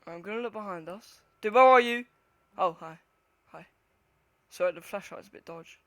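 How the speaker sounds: ordinary talk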